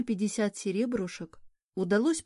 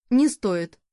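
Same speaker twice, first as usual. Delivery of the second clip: louder, curt refusal; sharp objection; 8 dB louder than usual. Acted. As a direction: cut her off sharply. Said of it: "резко возразила".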